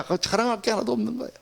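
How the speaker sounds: ordinary delivery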